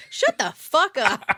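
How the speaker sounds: mock snapping back. Restaurant insists it's still Paris, snapping. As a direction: shut them down hard. Acted snappy reply, far, loud.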